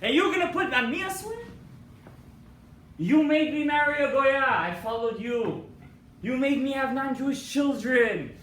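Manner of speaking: loud, high, outraged yelling; furious accusation. Acted. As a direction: hurl the blame at him. Dibuk accuses the rabbi.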